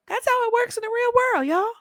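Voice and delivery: that's how it works, sung out high; squeaky emphatic; high emphatic punchline. Real, not acted.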